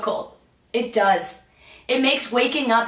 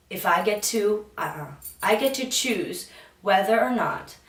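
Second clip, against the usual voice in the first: calm self-affirming voice; affirmation; 5 semitones lower, 7 dB quieter than usual. Acted. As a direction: speak each affirmation gently, letting it settle. Speaks affirmations.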